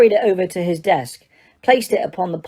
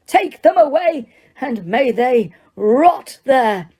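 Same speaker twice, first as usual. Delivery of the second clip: much higher, the rest slowed and spat; roaring; 7 semitones higher, 3 dB louder, slower than usual. Acted. Judge roaring at the Dementors, spitting. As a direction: roar with spit flying. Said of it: "Crouch roared".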